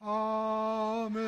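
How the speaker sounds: high, chanted rote Amen; mock congregation response. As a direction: parody a crowd answering on autopilot.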